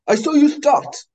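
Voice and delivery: put-on old man yelling across the pool, angry; shouted. Old man accusing the swimmer, loud angry shout. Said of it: "he shouted".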